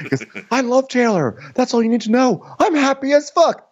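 very high, hammed-up protest; imitating a man insisting wildly; mock frantic declaration. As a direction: squeal it out in mock hysteria. Retold outburst by Shep.

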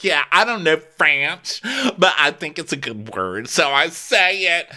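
mock her proud ignorance; ditzy girl voice. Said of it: "She's like".

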